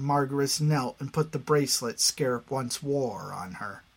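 ordinary delivery

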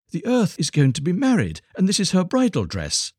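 very high voiced little dove; romantic turtle doves. A narrator's reading. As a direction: speak as a tiny high-voiced bird, no real whisper. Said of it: "whispered the turtle doves to each other".

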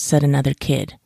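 plain reading voice

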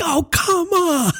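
high, stretched exasperated wail; frustrated yell. Retold or imitated. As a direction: cry it out like a fed-up dad at the end of his rope. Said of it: "Daddy got so frustrated".

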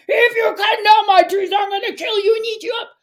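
high, fast troll threat; screaming and roaring. Acted. Huge troll threatening the boy, screaming. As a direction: roar it like an enraged troll. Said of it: "screaming and roaring".

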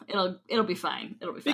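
quiet, throwaway mock reassurance. Cohost posing as careless doctor injecting a patient, softly reassuring. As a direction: soothe the patient under your breath.

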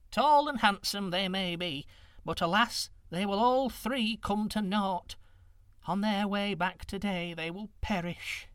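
lightly voiced old woman, sorrowful. A narrator's reading. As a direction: play the hundred-year-old wise woman.

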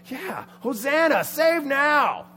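high, loud, drawn-out cheer; acted rousing crowd yell; joining the cry. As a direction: take up the chant with a shout. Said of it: "he picks it up".

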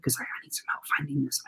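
put-on quick secretive whisper to a child; conspiratorial whisper. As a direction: whisper it fast like sharing a secret. Coaxing child into a game.

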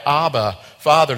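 projected, heartfelt calling out; great cry. Acted. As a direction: cry out to your father with force. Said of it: "with a great cry".

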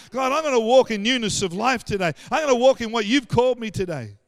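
loud, driving morning confession; fired-up declaration prayer. Acted. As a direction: proclaim it to God at full voice.

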